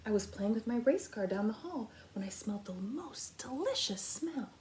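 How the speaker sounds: put-on whispered little boy; breathy child's whisper. Little boy telling Amu about the smell, whispered. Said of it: "the little boy replied".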